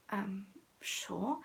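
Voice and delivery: softly imitated doubtful reply; doubtful murmur. Friends reacting to her French, quietly doubtful. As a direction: say it softly, unconvinced.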